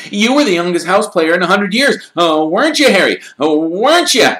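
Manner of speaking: put-on high, loud, eager boy's voice; excited young fan. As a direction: gush at your hero like a starstruck kid. Colin gushing.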